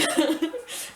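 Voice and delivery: short rising laugh; bright rising laugh; high laugh. Real, not acted. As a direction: laugh at yourself. Laughing at nickname.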